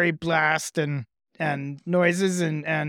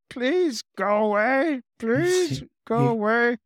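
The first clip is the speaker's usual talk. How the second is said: higher, whimpering plea from a scared lizard; whining lizard; 6 semitones higher than usual. Acted. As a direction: whine it like a beaten creature. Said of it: "It starts whining".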